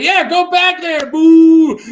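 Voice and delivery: loud, high mock booing; heckling. Carl just heckling the crow's eye, loud high jeering. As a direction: jeer from the crowd and draw out the boo. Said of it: "he just starts heckling".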